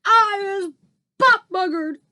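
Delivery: very high, loud screech; screeching giant. A narrator's reading. Trapped giant screeches in fury. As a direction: screech it out in outrage. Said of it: "screeched the butcher boy".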